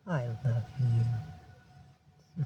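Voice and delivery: slow, low, soft declaration of love; tender. Real, not acted. Owner to her cat, slow and loving. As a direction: draw it out softly and low. Telling cat she loves him.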